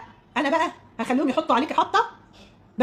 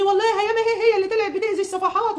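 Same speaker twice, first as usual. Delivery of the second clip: mimicked accuser; 6 semitones higher than usual. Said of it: "وعماله تقولي بقى للناس".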